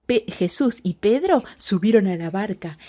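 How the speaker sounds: usual speaking voice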